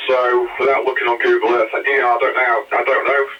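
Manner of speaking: ordinary delivery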